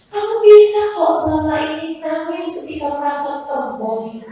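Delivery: high, loud sung preaching building to a cry; impassioned preaching. Acted. Preaching at full force.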